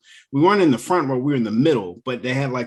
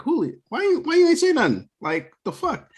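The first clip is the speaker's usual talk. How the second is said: much higher, exasperated demand; retold outburst at wife. Asking his wife why she stayed silent, high and exasperated. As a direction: squeak it out, baffled and annoyed. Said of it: "I'm like".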